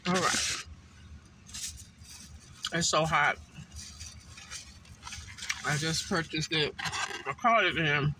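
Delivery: talking through a mouthful of food; mouth full. Real, not acted. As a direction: speak around a hot bite. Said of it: "I don't like to talk with my mouth full".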